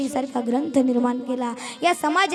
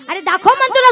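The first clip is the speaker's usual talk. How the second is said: sharp, louder bullying demand; harsh demand; 7 semitones higher, 12 dB louder than usual. Acted. Bully grabbing at the boy, harsh loud demand. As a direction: bark at the child to show the bag.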